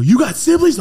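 loud, high-pitched disbelief; astonished. Retold or imitated. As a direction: blurt it out in shock. Acts out own startled reply.

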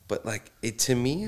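ordinary delivery

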